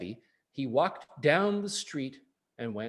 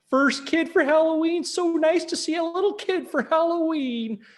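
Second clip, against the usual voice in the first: high, louder, delighted sing-song; sweet high greeting voice; 12 semitones higher, 5 dB louder than usual. Acted. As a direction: coo over a little kid. Big man greets trick-or-treater.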